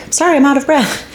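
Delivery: high, sing-song vlogger voice; mock vlogger apology. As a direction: parody the breathless vlogger line. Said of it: "you hear that a lot in these vlogs".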